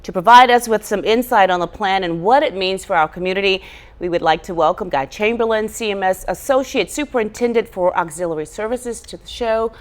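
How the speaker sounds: projected broadcast script read; announcer introduction. Acted. Host welcoming a school official to the show, in formal announcer style. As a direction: read it out as a programme host's intro.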